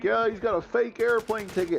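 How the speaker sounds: mimicking online trolls; mocking troll. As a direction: put on a high-pitched taunting voice. Voices the trolls' taunt.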